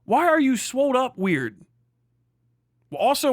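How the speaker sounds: usual speaking voice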